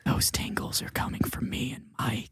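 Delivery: soft ASMR murmur; ASMR whisper. Pretend. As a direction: whisper it for the tingles. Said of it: "A S M R minute".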